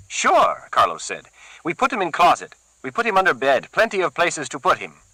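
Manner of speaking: a keen henchman keen to use the cleaver; Carlos, eager thug. Acted. Carlos offering his boss a fix, eager.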